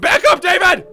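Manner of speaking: high, very loud warning; urgent shout. Acted. Warning David back from the experiment, urgent shout. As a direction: yell it out in sudden alarm.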